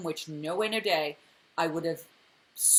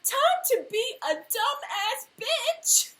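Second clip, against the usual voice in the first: high, singsong mock quote rising higher; mocking mimicry; 10 semitones higher than usual. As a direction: parrot it back with growing scorn. Mocking a character's line.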